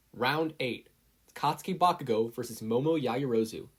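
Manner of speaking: ordinary delivery, nothing marked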